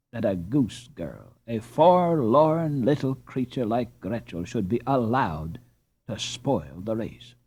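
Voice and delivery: usual speaking voice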